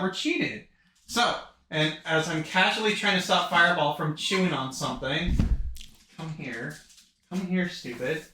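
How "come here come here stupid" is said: aside to the dog. Real, not acted. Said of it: "calling the dog aside".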